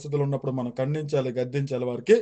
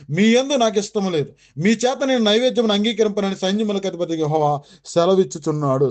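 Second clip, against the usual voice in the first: scripture reading; 3 semitones higher, 4 dB louder than usual. Acted. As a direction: proclaim each word of scripture deliberately. Reads Bible verse aloud.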